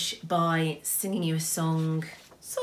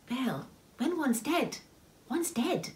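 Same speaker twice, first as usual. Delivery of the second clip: gentle, slow, higher-pitched consolation; kindly; 9 semitones higher, slower than usual. A narrator's reading. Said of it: "said Tooticky kindly".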